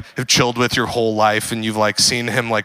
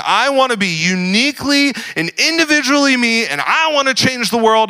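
mock earnest idealist; 11 semitones higher, 3 dB louder than usual. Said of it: "So many of us sit around going".

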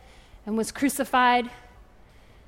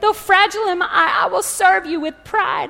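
high, showy storybook voice; pitched-up boastful goblet; proud crystal goblet; 7 semitones higher, 4 dB louder than usual. A narrator's reading. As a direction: pipe up high, displaying yourself proudly. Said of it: "cried the goblet".

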